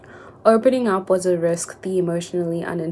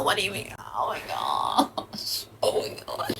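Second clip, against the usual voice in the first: breathless unvoiced whisper; hushed oh my gosh; shocked whisper; 10 semitones lower, 9 dB quieter than usual. Real, not acted. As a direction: whisper it in disbelief.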